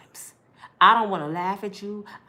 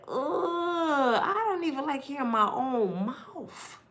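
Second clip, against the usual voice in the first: disgusted groan; 6 semitones higher, slower than usual. Retold or imitated. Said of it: "I said".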